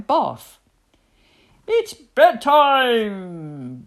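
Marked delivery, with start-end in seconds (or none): 1.7-3.8 loud, long drawn-out bellow; bellowing daddy hippo. A narrator's reading. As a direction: boom it out slowly, stretching the last word. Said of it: "bellowed Daddy Hippo".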